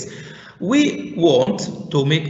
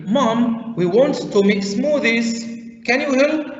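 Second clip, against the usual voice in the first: put-on child asking mom; 7 semitones higher than usual. Models child asking mom for help.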